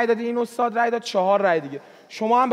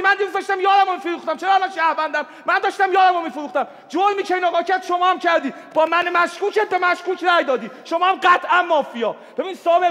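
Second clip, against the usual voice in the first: heated shouting; 8 semitones higher than usual. Real, not acted. Player yelling accusations at a suspected mafia. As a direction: shout him down, pitch up high. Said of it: "داد نزن فقط".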